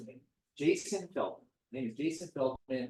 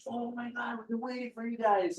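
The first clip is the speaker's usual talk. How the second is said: excited greeting; 10 semitones higher than usual. Retold or imitated.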